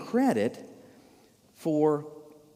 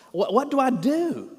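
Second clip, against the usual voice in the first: raised, pleading exasperation; exasperated; 8 semitones higher than usual. Acted. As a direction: at a loss, pitch climbing high. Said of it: "physical demonstration of his exasperation".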